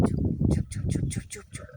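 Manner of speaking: rapid-fire repeated whisper, fading away; whispered hushing. Real, not acted. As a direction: shush them in sharp whispers. Hushing others while watching animals. (ফিসফিস করে বারবার চুপ করানো)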